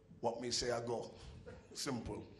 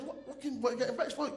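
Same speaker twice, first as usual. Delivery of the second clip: protesting son; 11 semitones higher, 4 dB quieter, faster than usual. Retold or imitated.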